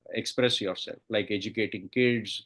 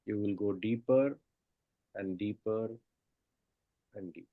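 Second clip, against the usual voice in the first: soft spa whisper; 4 dB quieter than usual. Acted. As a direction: lull them down with each count. Guided meditation countdown.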